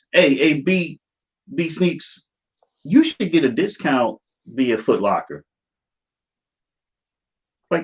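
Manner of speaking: ordinary talk, nothing marked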